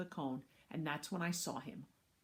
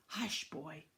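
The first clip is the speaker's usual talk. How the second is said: angry hush; 3 dB quieter than usual. Acted. Babysitter silencing the boy, mildly angry. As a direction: shush him with restrained anger. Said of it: "her voice angry".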